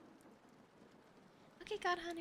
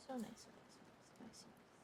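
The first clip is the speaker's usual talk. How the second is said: fading to whisper; 11 dB quieter than usual. Real, not acted.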